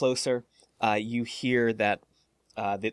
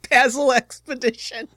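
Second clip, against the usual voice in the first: amused; 14 semitones higher, 9 dB louder than usual. Real, not acted. GM mimics mangled name.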